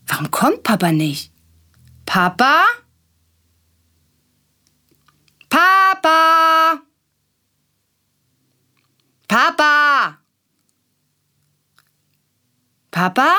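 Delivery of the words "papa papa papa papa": high, loud repeated calls, fading at the end; calling out. A narrator's reading. Willi calling for Papa who does not come, high and loud. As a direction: call into the dark house, waiting each time.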